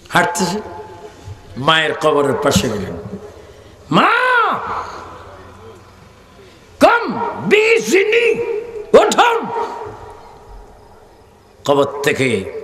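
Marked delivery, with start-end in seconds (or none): 3.9-9.5 high, long-drawn miracle command; slow, soaring call to rise; commanding cry. Acted. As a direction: summon the dead with a stretched shout. Saint commands the dead to rise.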